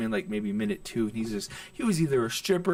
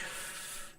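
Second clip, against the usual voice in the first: very soft acted sigh; retold sigh; 16 dB quieter than usual. Comic ten minutes into a bad set, sighing. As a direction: breathe out quietly, replaying your sigh. Acting out his onstage reaction.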